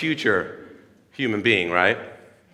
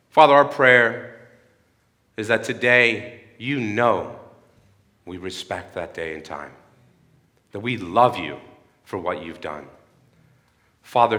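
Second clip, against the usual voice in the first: closing prayer; 3 semitones lower than usual. Acted. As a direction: pray over the congregation.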